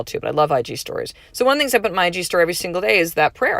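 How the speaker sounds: usual speaking voice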